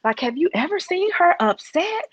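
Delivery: fast, high, gushing fan voice; new employee raving about her boss, mock gushing. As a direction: imitate a starstruck new hire. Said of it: "she was like".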